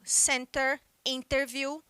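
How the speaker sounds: Center, interview with the T fully pronounced; British English clear T; British pronunciation. Shown as a demonstration. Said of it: "no inglês britânico o T é pronunciado".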